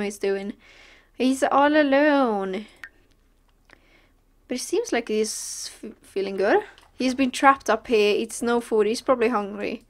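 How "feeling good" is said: ordinary talk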